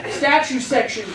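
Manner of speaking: normal talking voice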